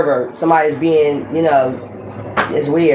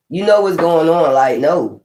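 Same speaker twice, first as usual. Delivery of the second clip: ordinary talk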